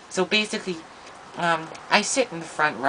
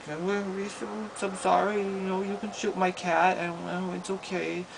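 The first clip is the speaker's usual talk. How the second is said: lightly voiced timid kid, higher; shy little self; 7 semitones higher, 3 dB quieter than usual. Narrator as a shy kid answering the bully, meekly. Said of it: "my shy little self being like".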